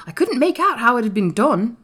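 high, loud, excited realisation; crying out. A narrator's reading. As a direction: exclaim it as a country lad. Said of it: "cried Dickon".

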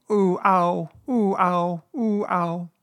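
high alternating "ooh, ow" yelps; jolted oohs and ows. A narrator's reading. Piglet bounced all the way home, jolted yelps. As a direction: yelp up and down with each bounce.

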